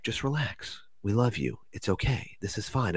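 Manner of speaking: soft, calming reassurance; soothing. Retold or imitated. Beings soothe the panicking speaker.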